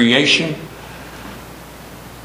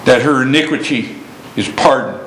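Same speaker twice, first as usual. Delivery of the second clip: hushed closing reading; 4 semitones lower than usual. Acted. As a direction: let the last verse fade gently.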